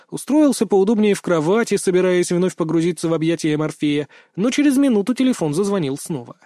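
ordinary delivery, nothing marked

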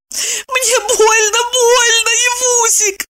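high, drawn-out tearful lament; wailing. A narrator's reading. Evdokia breaks into tears before Eva, wailing. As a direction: wail in pain, slow and high. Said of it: "причитала она".

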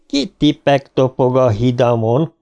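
ordinary delivery

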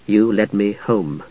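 remembered words echoing in his mind; echoing memory. A narrator's reading. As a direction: let the words drift back as a memory. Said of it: "the words echoed".

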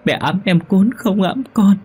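put-on high, tearful child complaining; crying ghost child. Ghost child tells mother of cold, high and tearful. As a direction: whimper like a cold little child. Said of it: "khóc nức nở".

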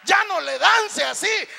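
slow, high, very loud reprimand; acted scolding shout. Annoyed churchgoer to a dancing worshipper, mock scolding shout. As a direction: holler at the dancer to quit.

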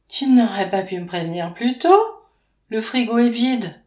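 irritated outburst, voice up; annoyed reproach. A narrator's reading. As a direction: scold him for the late notice. Faustine reproaches Maxime.

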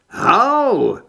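sharp high yelp of pain. A narrator's reading. Zed bitten by a zombie, sharp high yelp. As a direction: yelp as the teeth sink in. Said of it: "překvapeně jsem vykřikl".

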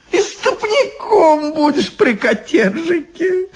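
high, slow witchy wheedle; wheedling Baba Yaga. Acted. Baba Yaga offers Ivan a stoker's job, wheedling. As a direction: promise it high and stretched out. Баба Яга сулит Ивану место.

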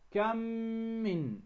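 كم من as one word with a long hummed m; idgham of كم من. Shown as a demonstration. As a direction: merge the two m's of كم من into one held m. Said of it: "ندغم الميم الأولى في الثانية". (إدغام ميم كم في ميم من مع الغنة)

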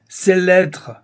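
father roaring for the letters; thundering voice. Acted. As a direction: thunder it at her. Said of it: "d'une voix formidable".

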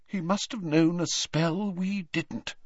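a light stage whisper, still voiced. A narrator's reading. McGonagall puzzling over Snape's escape, half whispered. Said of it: "whispered McGonagall".

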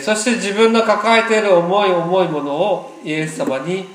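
ordinary talk

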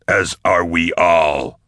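slow, drawn-out shouted toast; toasting cry. A narrator's reading. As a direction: call it out slowly like a toast. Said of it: "cried Nale, toasting".

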